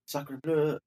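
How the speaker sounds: quiet, tentative French accent; attempted French accent. Shown as a demonstration. Friend testing whether he can do it, attempted French accent. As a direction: softly try out a French accent. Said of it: "let me try".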